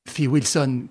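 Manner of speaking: plain reading voice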